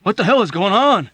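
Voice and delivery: high loud frightened demand; scared plea. A narrator's reading. Hungry Joe pleading to know what's happening. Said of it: "Hungry Joe pleaded".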